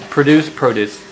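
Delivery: "Produce produce": the first stressed at the end, the second at the front as PROH-dooce; two pronunciations. Shown as a demonstration. Teacher repeating both readings of "Produce produce". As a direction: contrast the verb and the noun in "Produce produce". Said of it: "It's the word produce and produce".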